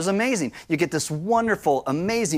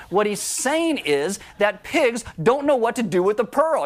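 ordinary talk

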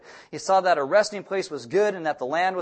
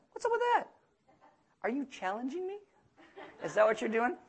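mock challenge; 6 semitones higher, 6 dB quieter than usual. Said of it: "I'm like".

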